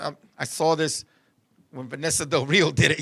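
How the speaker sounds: low, soft, halting confession; sheepish admission. Retold or imitated. Young lover admitting he copied a movie, low and sheepish. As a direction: mumble it low, caught out.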